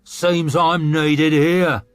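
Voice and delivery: slow, loud, bitter snarl; scornful snarl. A narrator's reading. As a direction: bite each word at the old man. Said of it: "snarled Magwir".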